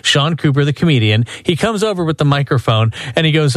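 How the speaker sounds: ordinary talk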